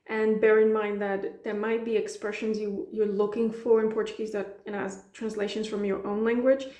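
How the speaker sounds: usual speaking voice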